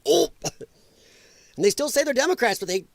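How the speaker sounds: normal talking voice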